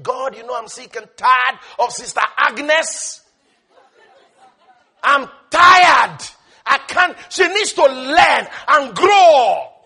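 loud fed-up outburst, building to a shout; hammed-up weary complaint, stretching the last words loudly; mock exasperation. Preacher voicing God sick of Sister Agnes, mock exasperated. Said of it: "He's not busy saying".

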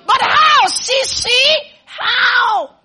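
very high, loud mock questioning; mocking, high-pitched cry of disbelief; insistent doubter. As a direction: wail the question like an exasperated doubter. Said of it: "Be there saying how".

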